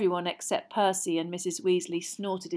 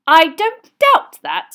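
stern mother; 10 semitones higher, 18 dB louder, slower than usual. A narrator's reading. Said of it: "frowning suddenly".

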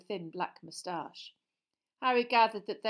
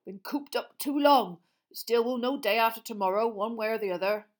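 Hagrid voice; 3 semitones higher, 8 dB louder than usual. Acted.

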